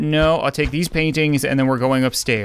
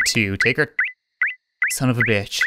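usual speaking voice

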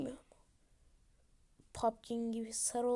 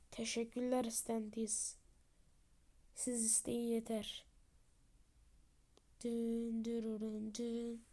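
headless servant voice; 5 dB quieter, slower than usual. Acted. Headless figure speaks low and slow.

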